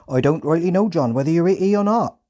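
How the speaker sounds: high, loud Hampshire farmer voice; doubtful Hampshire farmhand; Hampshire accent. Retold or imitated. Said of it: "again translated into British Standard English".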